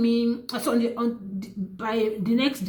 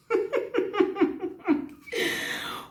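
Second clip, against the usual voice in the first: laugh; 12 semitones higher than usual. Real, not acted. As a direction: let a high little laugh escape. Laughs at her own reveal.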